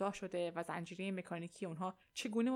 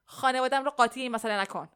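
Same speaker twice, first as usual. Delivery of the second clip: loud, raised-pitch angry outburst; angry shout; 4 semitones higher, 10 dB louder than usual. A narrator's reading. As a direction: shout it as a furious warning. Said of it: "جانی عصبانی شد و فریاد کشید". (فریاد عصبانی)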